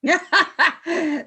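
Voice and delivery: short scornful laugh at the trapped man; cold mocking laugh. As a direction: laugh him off, cold and superior. Villain mocks trapped enemy.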